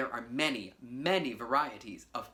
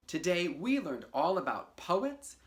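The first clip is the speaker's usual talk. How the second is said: normal talking voice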